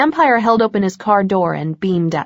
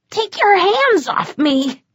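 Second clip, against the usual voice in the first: much higher, slow indignant protest; a trapped creep trying to sound in charge; panicked vampire; 12 semitones higher, slower than usual. A narrator's reading. Stabbed vampire protesting to her, high slow indignation.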